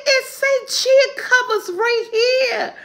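high, slow teary laughter; laughing. Real, not acted. Showing her son the label, laughing. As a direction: read it out while crying with laughter.

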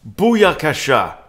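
big, loud, high catchphrase shout; triumphant exclamation. Real, not acted. Chess host celebrating a winning queen check, triumphant. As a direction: punch it out loud and high, stretching the last word.

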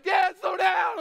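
high, loud plea to slow down; scared son shouting. Retold or imitated. Son begging speeding dad, high loud shout. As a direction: shout it from the passenger seat. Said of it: "My son's like".

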